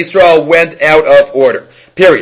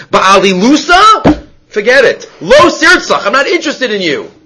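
scornful refusal; 6 semitones higher than usual. Retold or imitated. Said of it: "like Rava says here".

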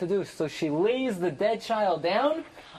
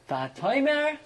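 ordinary delivery

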